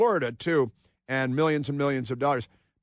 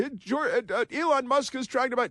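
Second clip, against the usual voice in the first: mocking her, pitched way up; mock shrill yelling; 12 semitones higher than usual. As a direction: imitate a frantic woman yelling, high. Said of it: "she's yelling".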